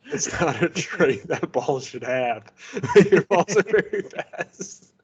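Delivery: slow goofy voice breaking into laughter; laughing. Pretend. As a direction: laugh through the words. Joking, laughing.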